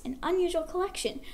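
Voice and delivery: ordinary delivery, nothing marked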